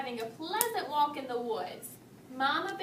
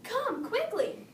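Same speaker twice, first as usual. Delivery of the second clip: much higher slow call; 9 semitones higher, slower than usual. A narrator's reading. Mama Bear calling Papa Bear upstairs, higher slow call. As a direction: call urgently up the stairs, lightly acted. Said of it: "she called out to Papa Bear".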